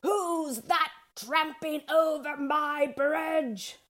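high, slow, stretched troll call; bellowing troll. A narrator's reading. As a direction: draw out the challenge as a bellowing troll. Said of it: "She bellowed".

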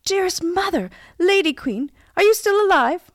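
loud, high call up to a mother; prince's anxious, high-pitched shout; crying out. A narrator's reading. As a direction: call out urgently from afar. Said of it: "and cried".

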